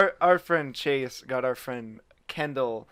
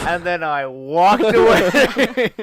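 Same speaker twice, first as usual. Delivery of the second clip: slow, louder, drawn out; 5 semitones higher, 11 dB louder, much slower than usual. Real, not acted. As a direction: stretch out the final line. Deadpan punchline, laughing after.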